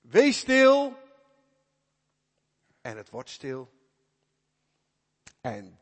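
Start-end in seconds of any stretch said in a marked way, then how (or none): 0.0-1.0 loud, high command to the storm; commanding rebuke. Acted. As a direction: silence the wind with full authority. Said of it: "spreekt hij". (luid gebiedend tegen de storm)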